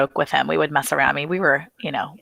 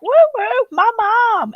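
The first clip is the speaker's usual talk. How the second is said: put-on high little-boy whooping; piping, way-up-high woos; toddler wooing; 4 dB louder, slower than usual. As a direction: imitate a tot's loud silly catcall. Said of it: "and he's like".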